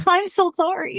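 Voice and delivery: high-pitched mock apology; teasing, high little apology; playful apology. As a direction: squeak out a joking sorry.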